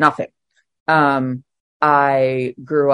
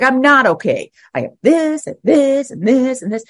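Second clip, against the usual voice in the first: high, loud, exasperated protest; retelling her own indignant complaint, pitched up; mad protest; 10 semitones higher, 4 dB louder than usual. Retold or imitated. Pushing back at her sponsor, retold as a high angry protest. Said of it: "Ah, that made me mad".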